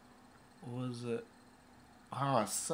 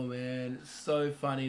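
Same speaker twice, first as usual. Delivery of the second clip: ordinary talk, nothing marked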